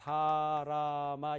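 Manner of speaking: 가나다라마 read evenly in drawn-out Korean syllables. Shown as a demonstration. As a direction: read 가나다라마 slowly, one plain Korean sound after another. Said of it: "한국말로". (가나다라마를 한국말로 길게 또박또박 읽기)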